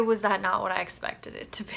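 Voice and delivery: hushed, unvoiced confession; a secret about Sherlock; dramatic whisper. Real, not acted. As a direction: whisper it conspiratorially. After "and boy.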